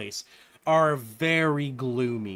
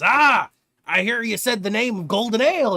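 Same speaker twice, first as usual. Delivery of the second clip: eager tavern man; 7 semitones higher, 7 dB louder than usual. Acted. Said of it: "And he says".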